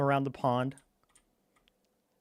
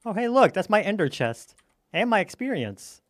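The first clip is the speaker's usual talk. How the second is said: ordinary talk, nothing marked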